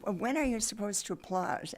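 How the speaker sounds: ordinary delivery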